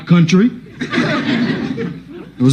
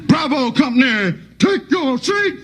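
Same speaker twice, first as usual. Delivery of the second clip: high, slow, deliberate drill sergeant; menacing sergeant; 10 semitones higher, 3 dB quieter, slower than usual. Retold or imitated. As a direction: savor every threat slowly. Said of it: "the sergeant would be bad".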